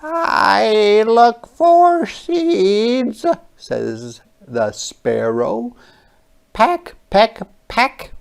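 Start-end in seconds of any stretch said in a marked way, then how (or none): 0.0-3.2 high, very slow little bird voice; a small sparrow, drawn out; storybook sparrow searching. Acted. 6.5-8.1 high, loud pecking; pecking sounds. Acted.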